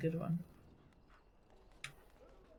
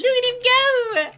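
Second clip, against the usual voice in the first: excited; 9 dB louder, slower than usual. Real, not acted. Delighted slow high exclamation.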